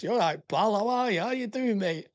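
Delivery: imitating a mate's St Helens accent, sing-song and high. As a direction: mimic a broad St Helens greeting, pitch climbing. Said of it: "and he talks like this".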